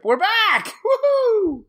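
high whooping cheer; excited cheer. Real, not acted. Cheering return from hiatus.

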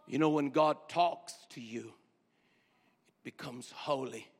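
usual speaking voice

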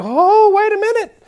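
high, alarmed double take, slowing on the question; startled. Retold or imitated. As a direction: play a newcomer thrown by the singing. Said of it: "and I'm like".

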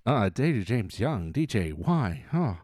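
lightly voiced, speaking through the teeth; Steve Buscemi. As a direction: lead with the teeth, kept low. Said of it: "can I get Steve Buscemi?".

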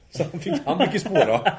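high, loud talking through laughter; real laughter. Real, not acted. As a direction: crack up while telling it. Berättaren skrattar åt minnet. (skrattande, knappt sammanhållet)

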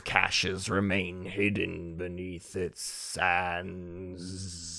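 slow, low, fading warlock read; dying old warlock. Acted. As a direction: read it like an old warlock on his last breath. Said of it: "like, an old dying warlock or something".

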